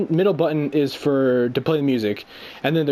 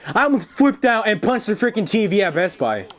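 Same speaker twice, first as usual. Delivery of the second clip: worked-up rant; 5 semitones higher than usual. Real, not acted. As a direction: rant like you can't believe your luck. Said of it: "I was so pissed".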